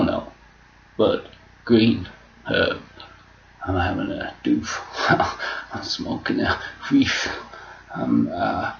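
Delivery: ordinary talk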